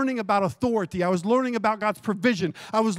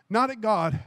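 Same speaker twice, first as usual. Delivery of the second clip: normal talking voice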